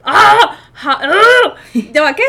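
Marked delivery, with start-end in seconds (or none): 0.0-0.6 loud, high frustrated "ah!"; sudden shout at a fumble; short high-pitched cry of exasperation; frustrated yell. Real, not acted. Fumbles the tongue twister. 1.0-1.5 loud, high "ah!" with laughter; high exasperated cry; frustrated yell. Real, not acted. Fumbles the tongue twister again.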